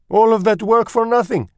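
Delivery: put-on very high, loud, aggrieved French accent; French mother mouse. A narrator's reading. Mother mouse laments her wasted labour, high and aggrieved. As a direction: complain as a theatrical French mother. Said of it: "said the mother".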